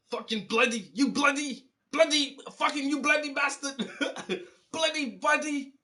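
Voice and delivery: mock road rage argument. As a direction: mimic two guys yelling bloody at each other, way up high. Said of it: "That was their whole argument".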